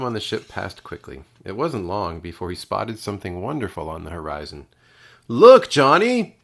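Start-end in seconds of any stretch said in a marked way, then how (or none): 5.3-6.4 high, loud joyful shout; shouting excitedly. A narrator's reading. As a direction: call out to Johnny in delight. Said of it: "he shouted".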